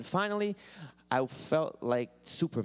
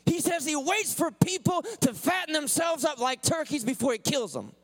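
high, loud, stretched preacher's cry; full-force preaching; 13 semitones higher, 4 dB louder than usual. Acted. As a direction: preach it out, half singing the lines.

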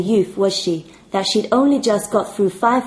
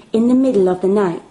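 ordinary delivery, nothing marked

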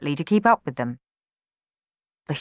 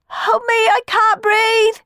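much higher, loud, slow wail; frightened wail; 7 dB louder, slower than usual. Acted. Boy stuck in the chimney wailing for help, high frightened wail. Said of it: "wailed Sammy".

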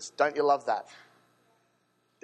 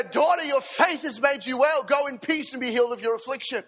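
tender Jesus voice; 7 semitones higher, 4 dB louder than usual. Acted.

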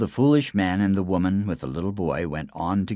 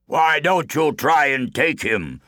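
slow, higher-pitched giant's dare; challenging giant; 6 semitones higher, slower than usual. A narrator's reading. Giant challenges his rival for the man, slow taunting dare. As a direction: dare him slowly, as a big giant. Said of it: "said the Giant of the Forest".

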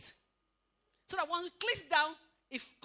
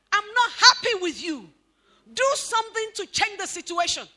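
pleading wife; 5 semitones higher, 15 dB louder than usual. Retold or imitated. Preacher voicing wife's real meaning.

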